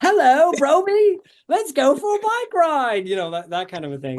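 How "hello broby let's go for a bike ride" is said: very high, loud British-Australian character voice; bad British accent. Shown as a demonstration. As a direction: botch a British accent till it sounds Australian. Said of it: "really bad British accent".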